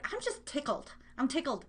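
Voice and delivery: normal talking voice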